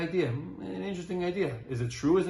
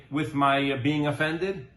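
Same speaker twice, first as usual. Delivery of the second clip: usual speaking voice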